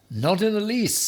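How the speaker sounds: very high, bright reply; Ham reassuring. A narrator's reading. Ham reassures the hedgehog: high light reply. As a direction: brush off the worry lightly.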